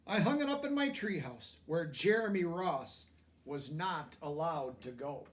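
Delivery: plain reading voice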